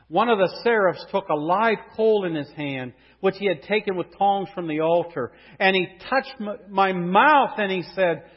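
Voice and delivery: loud, ringing pulpit voice; a loud, lifted preacher's cry; full-force preaching. Acted. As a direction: proclaim it to the whole room.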